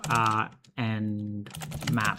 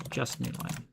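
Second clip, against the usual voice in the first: muttered repeat; 7 dB quieter than usual. Acted. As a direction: mutter it under your breath. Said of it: "just new line, please".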